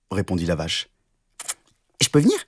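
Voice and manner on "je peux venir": much higher hopeful rabbit; little rabbit voice. Acted. Rabbit asks the cow to come along, high rabbit voice. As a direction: ask like an eager little rabbit. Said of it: "demanda le lapin".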